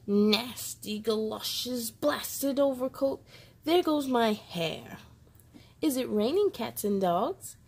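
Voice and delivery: lively picture-book read-aloud with voiced characters; reading to children. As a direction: bring each rainy-day page to life for little listeners.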